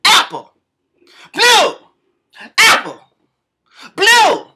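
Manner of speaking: high, loud, frantic shouting of "Blue!" and "Apple!"; screaming names. Retold or imitated. Two moms screaming for their lost kids in a playground. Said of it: "screaming out".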